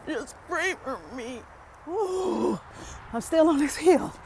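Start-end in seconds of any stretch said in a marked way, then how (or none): 1.9-4.1 straining uphill. Real, not acted. Climbing steep hill, straining.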